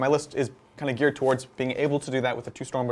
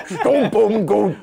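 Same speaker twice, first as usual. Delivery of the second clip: high, slow, hammy orc growl; 7 semitones higher, 6 dB louder, much slower than usual. Pretend. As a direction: ham it up like a battle-crazed orc. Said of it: "can we say that last one again, but with an orc voice".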